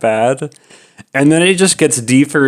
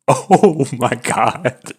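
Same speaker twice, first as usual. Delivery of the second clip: drawn-out disbelief; much slower than usual. Retold or imitated. Fleeing awkward encounter.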